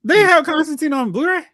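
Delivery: genuinely taken aback; surprised. Real, not acted. Surprised by the news.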